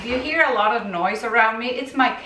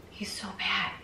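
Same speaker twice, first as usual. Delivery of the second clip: soft unvoiced whisper; whispered aside; 2 semitones lower, 13 dB quieter than usual. Real, not acted. Owner whispering to viewers about her naughty kitten. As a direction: whisper it like a secret.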